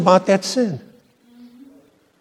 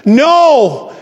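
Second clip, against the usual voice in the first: normal talking voice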